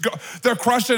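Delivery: ordinary delivery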